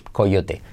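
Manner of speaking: coyote in Spanish, "ko-YO-te"; Spanish pronunciation. Shown as a demonstration. Teacher showing the Spanish word, coyote as "ko-YO-te". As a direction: say coyote slowly, every vowel as spelt. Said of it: "En español se escribe coyote, se dice".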